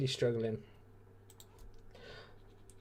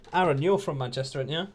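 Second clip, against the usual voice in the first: ordinary delivery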